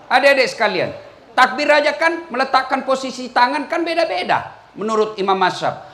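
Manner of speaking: usual speaking voice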